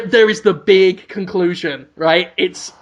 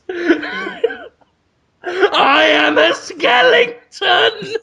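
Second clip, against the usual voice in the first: much higher, slow, laughing shout; ghoulish skull voice; 10 semitones higher, much slower than usual. Acted. The skull reveals itself to the hero in a high, slow, laughing shout. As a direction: draw it out like a cackling skull.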